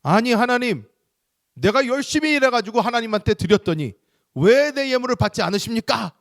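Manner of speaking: high, loud protest at God; indignant Cain. Acted. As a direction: demand an answer as the wronged Cain. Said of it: "자기가 더 위에 있습니다".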